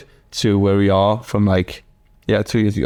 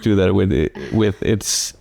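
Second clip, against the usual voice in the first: ordinary delivery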